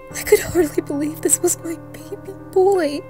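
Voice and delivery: acted horrified grief. Parent finding a mutilated child, horrified grief. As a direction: let the horror lift and swell the voice.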